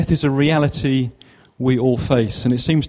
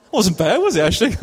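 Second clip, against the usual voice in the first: put-on joking, higher-pitched Jamaican accent; 6 semitones higher, faster than usual. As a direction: attempt a bad Jamaican accent for laughs. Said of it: "do the Jamaican accent".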